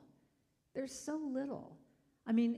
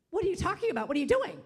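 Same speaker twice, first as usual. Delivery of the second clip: indignant; 8 semitones higher, 10 dB louder, much faster than usual. Retold or imitated. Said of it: "she goes".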